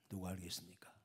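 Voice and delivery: low unvoiced whisper. Real, not acted. Preacher asking the crowd who could know: whisper. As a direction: lean in and whisper the question. Rhetorical hush to the congregation.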